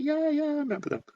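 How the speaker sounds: very high, gushing camp trill. Retold or imitated. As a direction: sing it up high like a gossiping queen. Both recalling old hometown gays.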